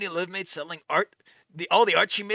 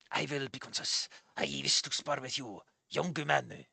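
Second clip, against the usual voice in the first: low, soft old man with mock Japanese accent; fake Japanese accent; 6 semitones lower, 10 dB quieter than usual. Old man challenges visitor to spar, fake Japanese accent. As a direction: pose as a Japanese master, quietly. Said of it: "end your fake Japanese accent on".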